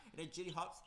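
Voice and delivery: ordinary talk, nothing marked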